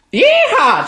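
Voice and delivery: high, loud hammed-up yeehaw; cowboy whoop. Texan host whooping at his ingredients, loud cowboy yeehaw.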